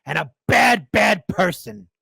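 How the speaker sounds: high, loud scolding rant; mock outraged shout. Streamer mocking Kamala supporters, loud shouting accusation. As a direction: ham up a self-righteous accuser. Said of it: "They're coming on out and be like".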